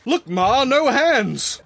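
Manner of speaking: mock boast. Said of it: "I was like".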